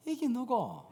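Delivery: high, astonished Gyeongsang dialect; Gyeongsang accent. Acted. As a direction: Philistine lords exclaiming in broad Gyeongsang speech. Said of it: "이게 경상도 말로 해야". (놀라서 높이 외치는 경상도 사투리)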